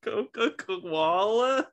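slow, high incredulous echo; drawn out in amused disbelief. Real, not acted. Repeats punchline in disbelief.

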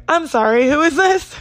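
confused phone question. Retold or imitated. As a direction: ask a stranger who's calling, rising up. Unknown caller, confused.

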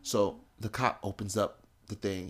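ordinary delivery, nothing marked

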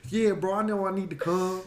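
high, loud imitation of a buddy; Tim impression. Host mimicking his boxer friend's excuse for not coming, high and loud. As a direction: do your pal's voice, pushed up and loud. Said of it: "That's how he tr".

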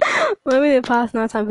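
high, loud mock excuse breaking into laughs; a teasing excuse that dissolves into laughter; laughing fake excuse. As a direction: giggle through the fake pious excuse. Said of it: "And you say".

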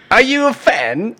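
high, slow put-on British voice; hammed-up British squeal; mock British accent. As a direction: tease with a drawn-out fake British question. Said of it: "talking in a British accent".

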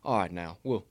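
low, quiet coach impression; folksy recruiting pitch, soft and deep; Saban. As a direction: talk a recruit round in a low, understated voice. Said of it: "He's spot on".

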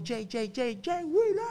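stage MC introduction. Retold or imitated. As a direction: build the name up like an MC hyping the crowd. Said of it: "me complace presentarle a todos". (presentación de animador en tarima, alargando el nombre con emoción)

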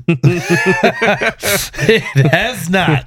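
high-pitched, drawn out through laughter; laughing. Real, not acted. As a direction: answer the tease while cracking up. Laughing while answering a tease.

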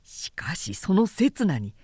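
plain reading voice

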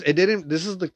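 normal talking voice

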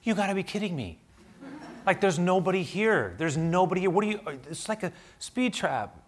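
mock outrage at an empty road; incredulous protest. Pastor protesting to the officer, in high incredulous laughter. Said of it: "I go".

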